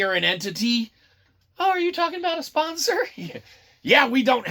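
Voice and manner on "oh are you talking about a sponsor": much higher, fast, mock-innocent; teasing co-host asks about a sponsor, high and fast; mock surprised question. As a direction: pipe up in a put-on voice.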